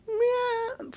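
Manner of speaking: high-pitched mock cat meow; imitated meow. Retelling the lost cat he heard, a high mock meow. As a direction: squeak out a cat's meow, way up high. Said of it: "Can hear the cat meowing".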